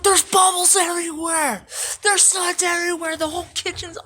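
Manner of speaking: high, loud alarmed yelling; guards yelling. Retold or imitated. Staff shouting at the inmates about the suds, high and loud. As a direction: shout it like the kitchen is flooding.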